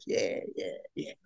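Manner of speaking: soft whispered yeah yeah yeah; whispered gloat. Retold or imitated. Intern gloating to herself after the call, whisper. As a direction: gloat under your breath.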